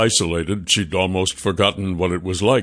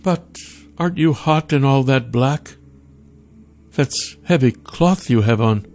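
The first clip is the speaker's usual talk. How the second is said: higher, inquisitive character voice; curious Sasha; 6 semitones higher than usual. Acted. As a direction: ask with friendly concern, pitched up. Sasha's dialogue voice.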